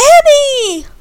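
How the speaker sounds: loud high call. Acted. Candy calling out to Annie at the car, loud and high. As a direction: call her name across the yard.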